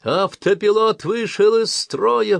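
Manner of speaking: high, slow, urgent shout; commander's shout. Acted. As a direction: yell it over the noise. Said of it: "послышался крик командира".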